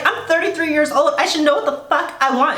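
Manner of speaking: mildly mocking, high-pitched protest; insistent self-justification. Bride explaining herself to the cameraman, mock insistent.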